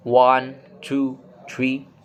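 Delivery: mechanical, level count on "one, two, three". Shown as a demonstration. Teacher shows level tone by counting, flat and mechanical. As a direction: count routinely with no rise or fall, almost bored. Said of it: "It sounds routine, mechanical, and almost bored".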